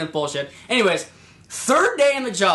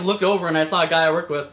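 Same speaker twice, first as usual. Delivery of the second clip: normal talking voice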